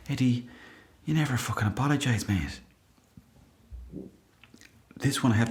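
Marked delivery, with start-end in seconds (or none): none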